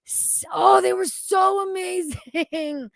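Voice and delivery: high, slow, swooning praise; excited gushing. Real, not acted. Streamer gushing to a viewer, excitedly. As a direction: swoon over how amazing they were. Gushing about favorite game characters.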